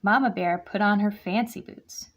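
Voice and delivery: usual speaking voice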